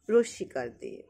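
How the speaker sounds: usual speaking voice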